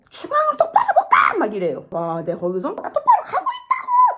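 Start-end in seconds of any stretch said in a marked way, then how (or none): 0.1-1.4 high, piercing mock shout; retold dad's yell. Dad suddenly yells at learner driver, mock shout. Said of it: "나한테 소리를 갑자기 지르는 거예요". 2.7-4.2 retold yelling back. Daughter yells back at dad while driving, mock scream. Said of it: "또 이게 된 거예요".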